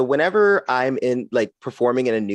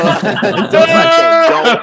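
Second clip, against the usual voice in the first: laughing plea; 10 semitones higher, 9 dB louder, much slower than usual. Real, not acted. Comic pleading with the laughing audience, louder, slow and laughing. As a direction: beg the crowd through laughter. Repeats catchphrase to crowd.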